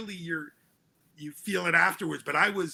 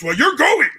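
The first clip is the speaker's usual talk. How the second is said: louder, higher stern father, half laughing; father laying down the law with a grin; father's verdict; 9 semitones higher, 11 dB louder than usual. Retold or imitated. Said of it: "He goes".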